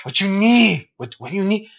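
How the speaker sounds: high mock Mexican accent; Swiper. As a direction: imitate a hustler with a put-on Mexican accent. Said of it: "Swiper's just a Mexican dude".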